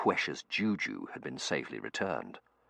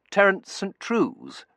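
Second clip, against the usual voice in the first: put-on young man voice; 8 dB louder, slower than usual. Terence character voice.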